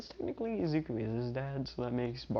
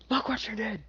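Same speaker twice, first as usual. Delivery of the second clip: mock outraged screech, way up high; acted indignation at a high pitch; high-pitched scolding; 10 semitones higher than usual. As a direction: squeal the rebuke in a high put-on voice. Repeats the scolding, much higher.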